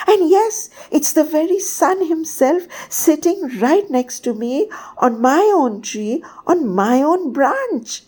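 high, animated little bird voice, rising to a squeak; tiny bird squeaking. A narrator's reading. Tiny bird tells the king of a dazzling dream, squeaky. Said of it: "Bidhi's voice rose to a squeak".

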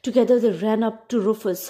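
ordinary delivery, nothing marked

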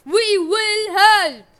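drawn-out, high, booming bellow; full-lung shout. A narrator's reading. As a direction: bellow every word slowly at full volume. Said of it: "shouted at the top of his lungs".

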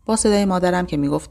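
plain reading voice

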